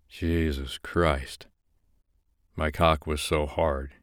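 ordinary delivery

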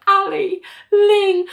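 high, hammed-up tearful gushing; mock tearful. Elaine about to thank her friends, mock tearful. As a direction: pretend to well up calling friends. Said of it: "Elaine suddenly gets really tearful".

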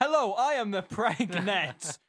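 slow, loud, hammed-up announcement; mock question-writer voice. Co-host mocking the question's writer, hammed-up. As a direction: declare it loudly in a put-on voice.

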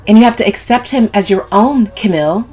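insistent scolding; voice rising. A narrator's reading. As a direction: push harder, voice climbing. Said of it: "her voice rising".

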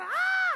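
piercing, hammed-up screams; screaming. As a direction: let out very high, loud staged screams. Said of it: "empieza a gritar".

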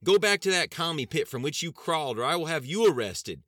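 high, furious sustained yell; loud shout. A narrator's reading. Sheriff threatening federal agents with arrest, shouting loudly. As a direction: keep yelling at full force. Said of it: "Sheriff Woods shouted loudly".

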